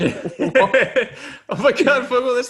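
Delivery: mock awe. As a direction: gush sarcastically over the story. Mocking Fogel with fake awe.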